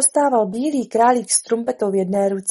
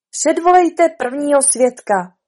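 slow, loud, raised order; royal command; 5 semitones higher, 8 dB louder, slower than usual. Acted. King orders the court to call witnesses, commanding. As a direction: command the court slowly and loudly.

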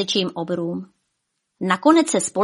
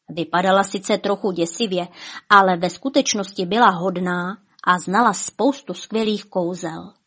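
plain reading voice

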